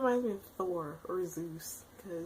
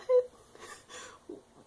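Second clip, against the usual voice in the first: high airless sob-laugh; a breathless half-sob, half-laugh of shock; crying laugh; 9 dB louder than usual. Real, not acted. Fan reacting to a death, sob-laughing.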